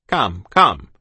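loud, low impatient prompting; officer shouting. A narrator's reading. Officer to dithering child, impatient shout. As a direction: hurry the child with brusque volume. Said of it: "he shouted in that way he had".

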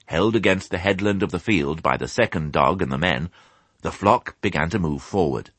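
ordinary delivery, nothing marked

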